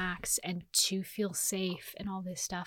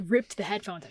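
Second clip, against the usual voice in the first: ordinary talk, nothing marked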